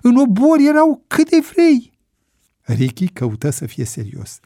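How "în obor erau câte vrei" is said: loud, very high-pitched disbelief; astonished. A narrator's reading. Bebe objects that cabbage is sold at the market, astonished. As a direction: protest in amazement, voice shooting up. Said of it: "întrebă Bebe uluit".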